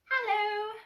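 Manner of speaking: little high piglet hello. Acted. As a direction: call out in a tiny high piglet voice. Piglet voiced.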